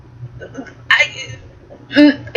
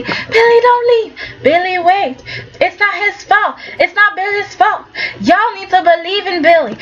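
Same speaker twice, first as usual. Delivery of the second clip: higher, pleading voice for Billy; pleading Phyllis; 6 semitones higher than usual. Retold or imitated. Phyllis begs the room to believe in Billy, pleading high. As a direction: beg everyone to trust Billy, pitched up. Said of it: "Phyllis is defending Billy".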